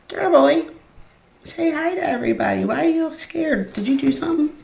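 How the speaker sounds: high, fast teasing pet voice; high cooing questions to a confused dog. Real, not acted.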